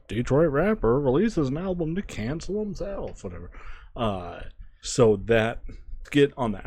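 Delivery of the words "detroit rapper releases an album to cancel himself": higher-pitched mock newscaster read; news reporter. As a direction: imitate a TV reporter announcing a headline, pitched up. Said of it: "with the news reporter".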